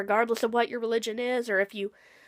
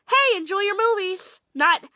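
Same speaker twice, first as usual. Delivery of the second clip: mock greeting; 7 semitones higher, 5 dB louder than usual. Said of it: "I'd say".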